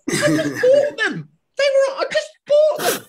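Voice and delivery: very high, loud, wailing self-defence; whiny protest. Acted. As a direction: plead it in a hammed-up squeal. Dad acts protesting to the lifeguard.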